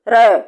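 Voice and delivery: a single clear voiced r, rolled lightly; demonstrating the r sound. Shown as a demonstration. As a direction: say र as the tongue taps the gums. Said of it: "र का उच्चारण कीजिए".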